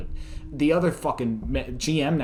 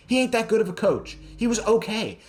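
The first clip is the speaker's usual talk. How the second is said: higher, louder ranting; rising heated rant; 10 semitones higher, 3 dB louder than usual. Real, not acted. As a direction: complain hotly about the coach. Ranting about the Raiders coach.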